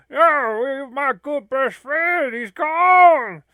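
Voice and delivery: over-the-top weepy lament; mock sobbing. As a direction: blubber over the lost friend. Mourning a deleted friend.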